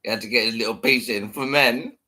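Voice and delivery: normal talking voice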